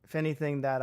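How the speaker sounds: normal talking voice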